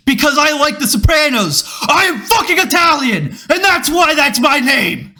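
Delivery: high, loud furious rant; screaming teacher. Retold or imitated. Carpentry teacher screaming at his class on day one, loud and high. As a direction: scream it like an unhinged shop teacher. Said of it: "introducing himself, by screaming at us like Gordon Ramsay".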